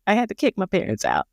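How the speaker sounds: ordinary delivery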